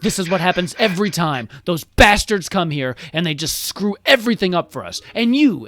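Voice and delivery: loud, high exasperated tirade; groan, then rail bitterly; furious rant. Acted. King rages about trusting Empire people, furious.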